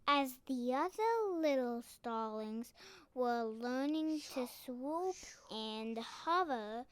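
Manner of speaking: ordinary delivery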